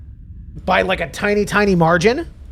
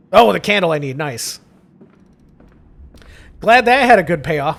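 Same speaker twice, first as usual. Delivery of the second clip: ordinary talk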